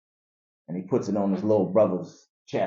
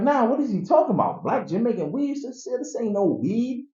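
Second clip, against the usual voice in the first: incredulous friend; 12 semitones higher than usual. Retold or imitated. Friend scolding about fake weed.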